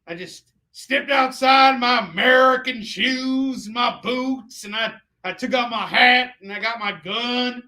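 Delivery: high, loud cartoon American drawl; mock cowboy-style American twang; hammed-up American accent. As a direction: stretch it out as a caricature American, high-pitched. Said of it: "I decided as an Amer".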